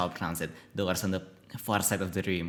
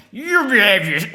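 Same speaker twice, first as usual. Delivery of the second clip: high, loud put-on mom; badly faked mom voice, pitched up; failed mom voice; 11 semitones higher, 11 dB louder than usual. Player retrying the mom's line, high mock mom voice. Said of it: "that's also not a mom voice".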